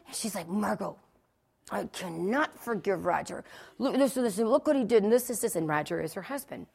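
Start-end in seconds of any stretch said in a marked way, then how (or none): 0.5-5.6 imitating a seething phone rant; mock seething, words forced through the teeth. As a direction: talk through clenched teeth, tightly furious. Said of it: "talk through your teeth".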